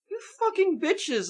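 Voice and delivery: high, slow mock outrage; retold exasperation. Annoyed moviegoer cursing the squeamish ones, high exasperated whine.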